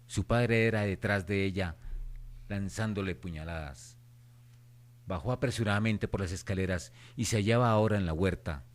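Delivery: plain reading voice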